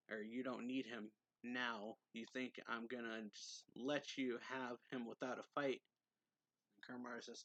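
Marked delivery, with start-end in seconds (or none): none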